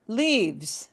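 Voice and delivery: normal talking voice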